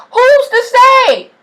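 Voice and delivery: a sky-high dare, stretched out; slow high challenge. Shown as a demonstration. Vlogger urging listeners toward stardom, slow and high. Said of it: "rhetorical question repeated".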